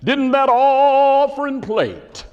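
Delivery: high, loud, long-drawn preacher wail; preacher's voice. Retold or imitated. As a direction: intone the question like a hammed-up pulpit sermon. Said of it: "that's the way he talked right there".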